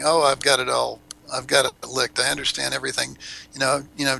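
normal talking voice

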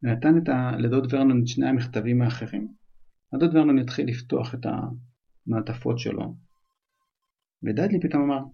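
ordinary delivery, nothing marked

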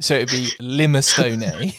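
"Limestone" as "lime-STRO-nay" with an extra syllable; limestone like minestrone. Shown as a demonstration. Host demoing the swap, limestone like minestrone. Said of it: "pronounced like minestrone".

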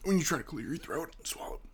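hoarse whisper from burning throat; strained whisper. Real, not acted. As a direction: barely get it out, whispering.

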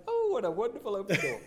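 high, loud, laughing burst of delight; delighted exclamation. Retold or imitated. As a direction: exclaim with joyful laughter at the opportunity. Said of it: "And it was like".

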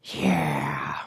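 low, soft celebrity impression; put-on actress voice, dropped low; Jennifer Coolidge. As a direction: draw it out low and quiet. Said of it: "looking like Jennifer Coolidge".

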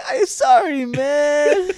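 high, loud mock crying; hammed-up tearful plea. Lalin begging betrayed friend Carlito, mock-tearful apology. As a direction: wail it like a pathetic whimperer.

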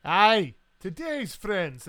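loud, slow Scottish toast; hearty toast. Acted. Scot answers the captain's toast, loud and hearty. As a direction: raise a glass with a booming "Aye".

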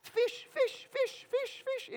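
very high, repeated crowd chant; cheering onlookers. Retold or imitated. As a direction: egg on the angler in a high voice. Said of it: "all the people standing around are saying".

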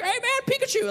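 put-on very high, chirpy child voice; imitated squeaky kid chatter; suddenly cheerful kid. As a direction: pipe up like a kid who forgot he was crying. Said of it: "completely stops crying, turns the tears off".

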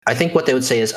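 ordinary delivery

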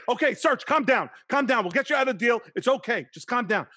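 loud, high-pitched pleading to settle him; calming friend. Retold or imitated. Record exec soothing a panicked Serge, loud and high.

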